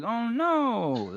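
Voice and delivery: lightly voiced little girl dismay. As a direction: squeak it like a small girl. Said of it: "a girl going like".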